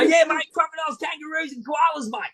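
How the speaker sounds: high-pitched, excitable Aussie croc-hunter voice; Steve Irwin. Retold or imitated. Tourist answers excited Mexicans, hammed-up croc hunter. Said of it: "I whipped out Steve Irwin impersonations".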